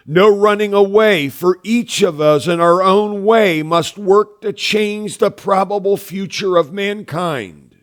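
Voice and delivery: high, loud, urgent declaration; emphatic. Retold or imitated. Grandfather answering his student's plea about wilderness, emphatic. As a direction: press each phrase forcefully, easing toward the end. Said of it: "responded emphatically, saying".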